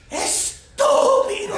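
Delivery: lady yelling from her beat-up car; furious yell. Retold or imitated. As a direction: screech it from the car window. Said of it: "and she goes".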